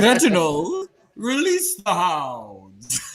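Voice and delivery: loud, slow, grand command; regal villain. Acted. Quoting the character to a castmate, hammed-up haughty command. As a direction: order the servant like a haughty queen.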